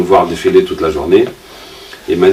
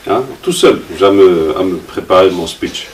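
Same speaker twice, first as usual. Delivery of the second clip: normal talking voice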